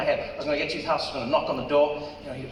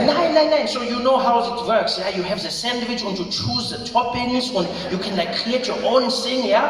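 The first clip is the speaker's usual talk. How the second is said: put-on high, loud, insistent German accent; Rolf; 5 semitones higher, 5 dB louder than usual. German friend explaining how sandwiches work, German accent.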